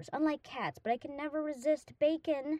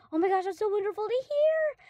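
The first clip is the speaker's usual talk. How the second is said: overjoyed; 10 semitones higher, 7 dB louder than usual. Real, not acted. Hears she's his best friend.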